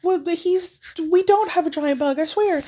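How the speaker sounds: high, pleading mock mom and sister; a lightly hammed frantic family denial; mock panicked denial. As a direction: squeak out a frantic cover-up, hammed up. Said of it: "the mom and the sister are like".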